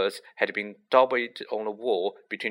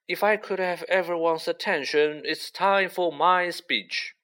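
loud announcement; 6 semitones higher than usual. A narrator's reading. Nick demands the guests' attention, grandly. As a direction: call the room to order. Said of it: "said nearly headless Nick loudly".

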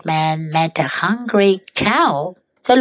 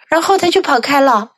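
ordinary delivery

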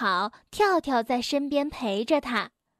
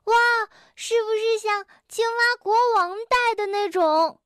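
wide-eyed friend gasps; amazed; 7 semitones higher than usual. A narrator's reading. Tiaotiao marvels at the crown idea, amazed. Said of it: "跳跳惊叹道".

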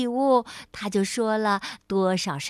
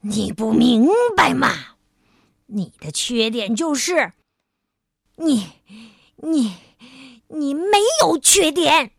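louder, stammering outburst; exasperated shout; 6 dB louder than usual. A narrator's reading. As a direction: shout it, stumbling over you. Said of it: "傲慢先生喊道".

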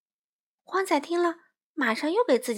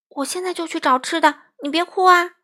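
high, louder desperate plea; pleading; 7 semitones higher, 7 dB louder than usual. Acted. Crow begs the sheep not to cry, high and loud. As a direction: beg him to stop crying. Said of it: "花袜子恳求道".

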